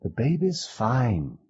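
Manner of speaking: slow, gentle reassurance; calm the child down; soothing. A narrator's reading. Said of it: "Maggie said soothingly".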